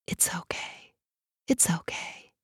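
faint breathy whisper, barely there; drowsy soothing whisper. A narrator's reading. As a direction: lull the child, whispering it twice. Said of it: "she whispered".

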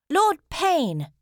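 high, loud call through a closed door; shout. A narrator's reading. Minerva calls through thick oak planks, shouting. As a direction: shout for the lord to hear. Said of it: "she shouted".

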